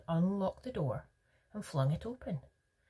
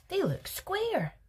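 very high, drawn-out insistence; stubborn objection; slower than usual. A narrator's reading. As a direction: insist slowly in a high pitch. Veruca repeats her objection.

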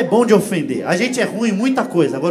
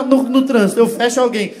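ordinary talk, nothing marked

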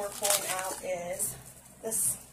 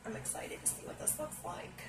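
breathy hushed anticipation, much softer; excited whisper; 6 semitones higher, 12 dB quieter than usual. Real, not acted. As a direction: whisper it like a secret before the reveal. Anticipating the palette reveal.

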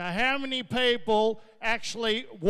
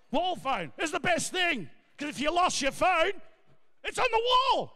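shouting; 5 semitones higher than usual. Real, not acted. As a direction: shout the punchline out. Punchline shouted.

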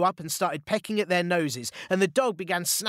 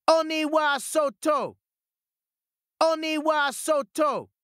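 high, slow and louder battle cry; louder shout; 12 semitones higher than usual. A narrator's reading. Peach Boy shouting again at ignoring ogres, louder. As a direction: shout even harder this time. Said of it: "shouted again, even louder".

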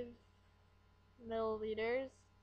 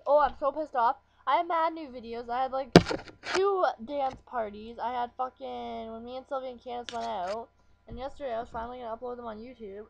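loud frustrated rant about lost videos; vent, loud and annoyed; real irritation, louder; pissed-off rant; 10 dB louder than usual. Real, not acted. Ranting about deleted videos.

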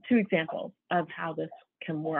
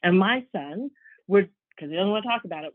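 ordinary delivery

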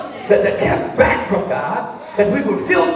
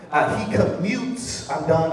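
ordinary delivery

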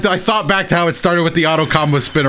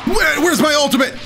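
panicked shout; 12 semitones higher than usual. Real, not acted. Panicked search for ultimate.

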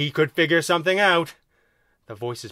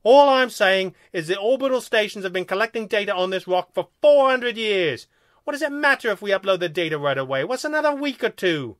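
raised, loud alien pleading his case; exasperated second alien; 6 semitones higher, 5 dB louder than usual. A narrator's reading. Second alien arguing to delay the upload, exasperated. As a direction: argue in a higher, insistent voice.

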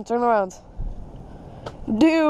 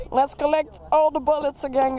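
usual speaking voice

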